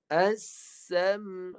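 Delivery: As-sam' recited with the ain stopped in the throat; teacher chants As-sam' with the ain held still; recited demo. Shown as a demonstration. Teacher showing students a dead ain, recited. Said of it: "dengar saya baca".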